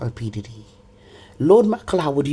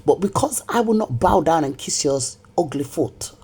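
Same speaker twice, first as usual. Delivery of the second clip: usual speaking voice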